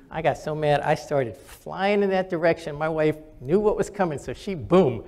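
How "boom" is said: ordinary delivery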